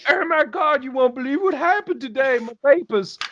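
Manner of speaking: very high, hammed-up flustered squeal; scandalized witness. Witness rushing into the precinct, mock horrified. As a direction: play mock shock in a high put-on voice. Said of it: "you're coming into the precinct, and you're like".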